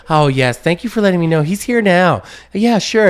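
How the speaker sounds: high, polite put-on phone manner; mom's phone voice. Child imitating mom on the phone, politely high-pitched. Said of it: "She'd be on the phone".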